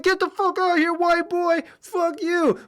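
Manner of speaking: high, childish mock yelling insults; kids screaming. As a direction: squeal it like angry ten-year-olds. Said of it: "they just start screaming".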